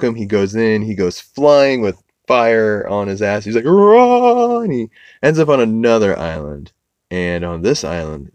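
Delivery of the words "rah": loud, high comic roar; high imitation howl; mock yell. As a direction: holler it like a cartoon man sent flying. Fire on his behind, Johnny flying.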